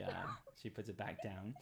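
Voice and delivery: usual speaking voice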